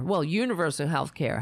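normal talking voice

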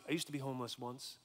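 very soft, low-pitched disclosure; quiet confession. Retold or imitated. Young man confiding in homeless stranger, subdued. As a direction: confide it gently, almost under the voice.

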